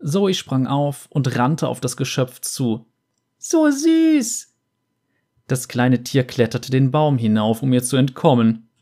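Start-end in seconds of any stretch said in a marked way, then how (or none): 3.4-4.5 high squeal of delight; squealing girl. Acted. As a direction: gush over something cute. Zoe gushing over creature.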